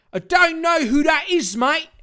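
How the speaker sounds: put-on high, loud Australian accent. Villain answering Dave in an Australian accent. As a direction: toss it off in an Aussie accent. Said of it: "a lot more Australian".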